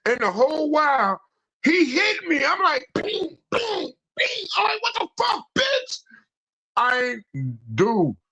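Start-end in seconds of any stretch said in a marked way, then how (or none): 3.0-6.0 squeaky ping ping, then a high yell; high-pitched mock yelp and yell; yelping punch sounds then high outburst. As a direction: yelp each hit, then cry out high. Retold getting hit, yelping.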